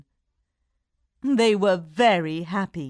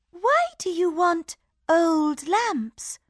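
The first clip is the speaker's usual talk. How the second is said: high, curious princess voice; cartoon princess; 9 semitones higher, slower than usual. A narrator's reading. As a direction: innocent asking princess.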